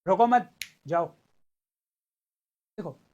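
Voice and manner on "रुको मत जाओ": रुको मत in one loud breath, then जाओ after it; रुको मत run together. Shown as a demonstration. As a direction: join मत to रुको, as in "don't stop, go". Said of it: "अर्थ अनर्थ हो जाएगा".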